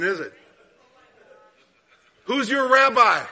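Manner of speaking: loud, slow, emphatic questions to the congregation; fervent sermon delivery; preaching. Acted. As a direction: drive each question home from the pulpit. Preaching at full force.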